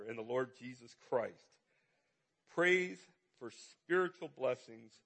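ordinary talk

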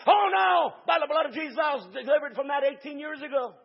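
loud, high protest, eyes wide; hammed-up outraged denial; indignant shout. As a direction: swear it loudly by the blood of Jesus. Drunk guy's loud denial.

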